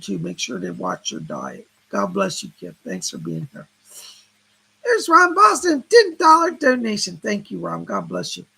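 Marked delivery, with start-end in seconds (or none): 4.8-7.2 loud, high call-out of a donor; announcing a donation. Real, not acted. Host thanks a viewer for a donation, loud announcing. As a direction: sing out the name and amount, raised and loud.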